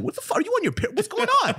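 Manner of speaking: high, squeaky bewilderment; comic incredulous outburst; mock outraged disbelief. Retold reaction to being insulted.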